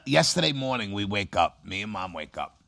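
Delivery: normal talking voice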